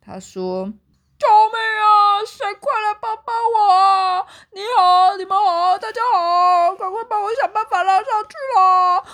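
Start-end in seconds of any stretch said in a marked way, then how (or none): 1.2-9.0 high, loud tearful cries for help; scared crying for help. A narrator's reading. Bear trapped in the hole crying for help. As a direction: wail for rescue from the hole. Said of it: "大毛熊好害怕哦".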